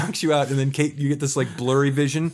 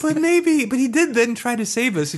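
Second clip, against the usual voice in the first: high mock Kate voice; Kate pleading; 12 semitones higher, 3 dB louder than usual. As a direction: plead his case in a raised voice. Kate defending the player.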